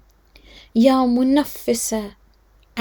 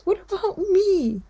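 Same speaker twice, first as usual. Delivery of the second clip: put-on child's plea; 8 semitones higher than usual. Sakina asks her father.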